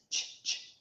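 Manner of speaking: whispered "ch" repeated as a sound, no vowel; phonics sound. Shown as a demonstration. Teacher to class, isolating the ch sound in a whisper. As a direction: breathe out short "ch, ch" like a phonics drill. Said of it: "what sound I need".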